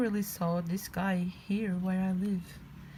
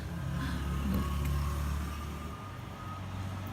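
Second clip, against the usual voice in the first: laughing. Real, not acted. Laughs at the toy.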